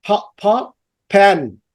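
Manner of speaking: two puffed "pa"s, then "pen"; P drilled alone, then in "pen"; aspirated P in "pen". Shown as a demonstration. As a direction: puff the P into "pen". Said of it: "বাতাস বের হচ্ছে".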